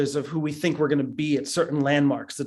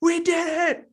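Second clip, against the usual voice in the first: retold screaming; 13 semitones higher, slower than usual. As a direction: shout the news like the friend on the phone. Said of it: "he just calls up screaming".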